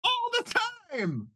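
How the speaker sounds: squeaky high gnome voice, shrieking with glee; manic gnome. Acted. Gnome showing off to the space pirate, squeaky and manic. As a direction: pitch way up like a giddy little gnome.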